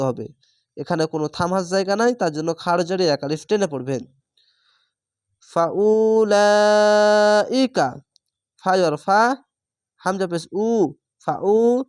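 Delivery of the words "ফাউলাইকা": ফাউলাইকা with লাই held very long and slightly raised; four-beat madd. Shown as a demonstration. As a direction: hold ফাউলাইকা's long vowel for four beats. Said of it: "চার আলিফ টেনে পড়বেন".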